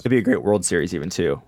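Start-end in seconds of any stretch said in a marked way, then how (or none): 1.1-1.4 a sudden break in the voice; voice crack. Real, not acted. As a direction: voice cracking on the word. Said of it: "Excuse my voice crack".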